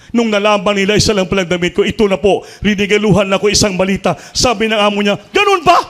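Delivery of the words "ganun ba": much higher, louder amazed exclamation; surprised employer. Acted. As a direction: exclaim it in sudden wonder. Employer moved by the story.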